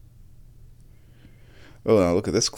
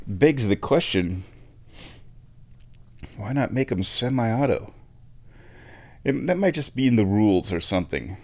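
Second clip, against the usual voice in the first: normal talking voice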